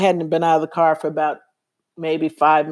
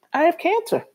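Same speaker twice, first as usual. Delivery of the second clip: much higher, strained; stunned confession; 13 semitones higher than usual. Retold or imitated. Telling a colleague the diagnosis in disbelief. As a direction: say it as if you can't believe it.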